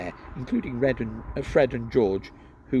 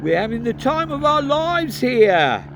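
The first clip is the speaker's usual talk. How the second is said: muffled sarcasm; 13 semitones higher, 7 dB louder than usual. Acted. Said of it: "voice sounded muffled, squashed against the wall".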